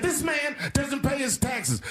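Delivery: high, slow, drawn-out indictment; mock accusation. Hillary Clinton trying to punch him on taxes, mock accusing. Voicing debate opponent's accusation.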